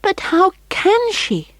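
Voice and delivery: frightened and sad. Acted. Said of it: "frightened and sad".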